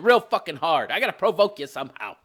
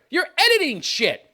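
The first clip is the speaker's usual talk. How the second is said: normal talking voice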